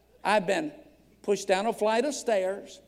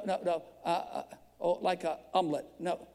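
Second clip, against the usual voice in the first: ordinary talk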